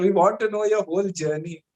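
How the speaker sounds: ordinary talk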